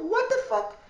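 shocked outburst. Retold or imitated. Finds friend shot in bedroom.

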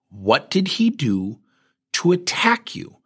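slowed-down, deliberate questioning; slow, pointed question. Retold or imitated. Pressing a woman on her accusation, slow and pointed. Firm interviewer repeats his question.

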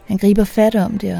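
plain reading voice

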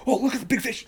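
high-pitched imitation of a thrilled angler; mock excitement. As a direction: play the over-excited angler, poking fun. Mimics angler seeing a big fish.

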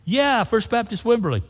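high, puzzled repeat of the name; surprised echo. Retold or imitated. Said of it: "He goes".

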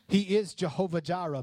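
ordinary delivery

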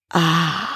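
drawn-out breathy ah; empty the lungs on a long ah; long exhaled ah. Shown as a demonstration. Host leads the last exhale, long sighing ah. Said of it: "And now exhale that long".

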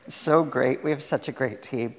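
ordinary talk

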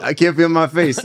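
amused, teasing the numb guest; laughing repeat. Retold or imitated.

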